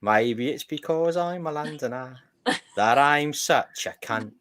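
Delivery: slow, hammy Londoner voice; bad English accent. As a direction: drawl it out as a cheeky Londoner. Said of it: "appalling attempt at an English accent".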